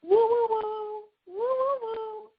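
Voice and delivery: high, slow, singsong woo woo woo; Sinclair catchphrase. Acted. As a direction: coo it up high like a daffy sitcom character. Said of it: "my own sitcom phrase".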